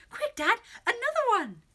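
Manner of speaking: high, loud, eager demand; urgent excitement. A narrator's reading. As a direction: urge Dad on, loud and high. Leo urgently asks for more food.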